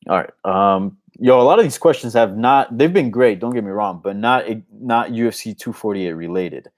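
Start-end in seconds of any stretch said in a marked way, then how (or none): none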